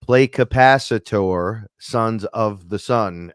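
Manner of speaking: "Play [name], [name]" slow and loud to Alexa; talking to Alexa, slow loud command. Shown as a demonstration. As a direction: spell it out slowly for the smart speaker. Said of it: "I'm like".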